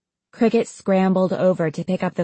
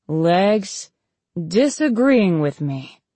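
slow, groggy slur; woozy; slower than usual. Acted. Wobbly patient after a sprawling landing, woozy. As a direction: draw the words out tipsily.

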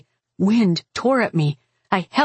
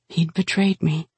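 plain reading voice

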